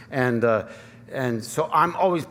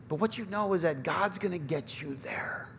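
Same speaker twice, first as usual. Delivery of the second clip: hushed reassurance; 3 semitones higher, 8 dB quieter than usual. Acted. Preacher softens to reassure.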